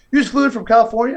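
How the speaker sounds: high, quick, disbelieving; retold incredulous question. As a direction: act out someone astonished by the news. Door man retold, astonished at trip.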